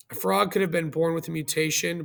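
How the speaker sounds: usual speaking voice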